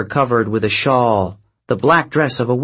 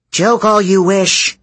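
clipped and cold, a touch raised; 8 semitones higher, 4 dB louder than usual. Acted. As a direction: bite the words off short. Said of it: "Hera said in a clipped tone".

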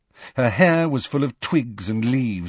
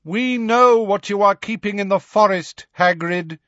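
high, raised-voice warning call; calling after; 11 semitones higher, 4 dB louder than usual. A narrator's reading. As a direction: call it after someone walking away. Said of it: "Megorian called after them".

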